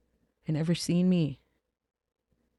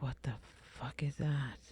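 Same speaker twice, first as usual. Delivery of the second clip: retold whisper of disbelief; hushed reenactment of shock; stunned whisper; 13 dB quieter than usual. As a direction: whisper it, completely baffled. Retelling her stunned reaction.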